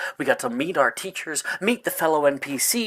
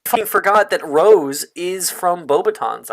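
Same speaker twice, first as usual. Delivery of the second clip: excited; 5 semitones higher, 6 dB louder than usual. Real, not acted. Continues sudden realisation.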